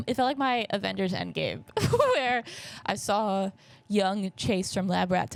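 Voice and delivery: ordinary delivery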